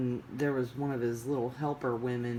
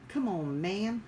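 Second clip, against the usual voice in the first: mild Biden-style come on man; lightly imitating Biden; Joe Biden; 6 semitones higher than usual. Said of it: "I sound like Joe Biden".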